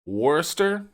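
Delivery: Worcester said as WUSS-ter, questioning rise. Shown as a demonstration. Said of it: "Let me tell you how it's pronounced".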